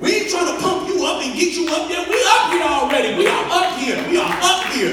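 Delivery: loud, high, driving preacher's cry; shouting the sermon's climax; fiery preaching. Acted. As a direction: lift the church with each line. Preaching at full force.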